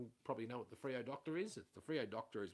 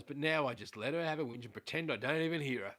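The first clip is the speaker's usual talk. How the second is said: normal talking voice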